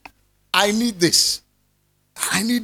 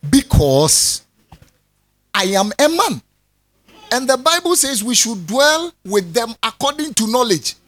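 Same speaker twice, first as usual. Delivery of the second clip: ordinary delivery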